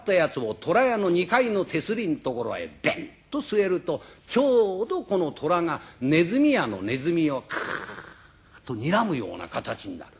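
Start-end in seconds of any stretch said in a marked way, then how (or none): none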